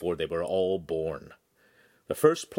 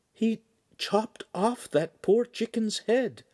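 much higher, stunned stammer; dazed girl; 13 semitones higher, slower than usual. Acted. Shamira telling the signora what she saw, stunned. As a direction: still in shock from the chopping.

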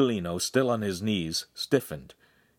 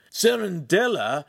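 high, slow, louder disbelief; appalled suitor; aghast gasp; 11 semitones higher, 5 dB louder, slower than usual. A narrator's reading. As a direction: repeat the name in horror. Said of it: "Ugolino gasped".

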